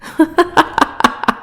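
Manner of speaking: airy, cruel little laugh; breathy villain laugh. Acted. Goddess laughs over his shame.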